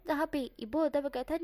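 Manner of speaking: ordinary delivery, nothing marked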